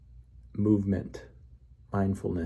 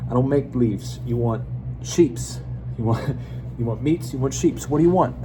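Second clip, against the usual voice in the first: pushy butcher ad; 4 semitones higher, 4 dB louder than usual. Acted. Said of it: "He said".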